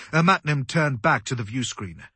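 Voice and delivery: plain reading voice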